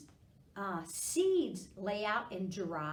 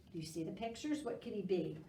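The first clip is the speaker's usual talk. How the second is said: usual speaking voice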